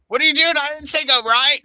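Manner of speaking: loud, high mock scolding of the sat nav; mock GPS scold. Teasing the GPS for a wrong turn, loud and high. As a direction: yell at the navigation voice in jest.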